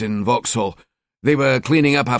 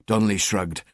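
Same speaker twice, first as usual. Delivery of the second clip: ordinary delivery, nothing marked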